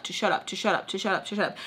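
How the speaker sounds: a muttered inner voice sinking away; fading inner voice. Retold or imitated. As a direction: let it trail off under the breath.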